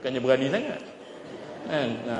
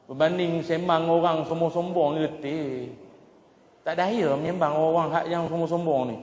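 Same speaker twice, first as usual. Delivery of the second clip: normal talking voice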